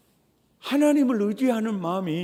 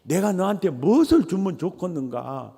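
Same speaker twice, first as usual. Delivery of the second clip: Jeolla dialect. Retold or imitated. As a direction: coax the listener in Jeolla dialect, '말 좀 해야'. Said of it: "이 말을 전라도 말로 하면".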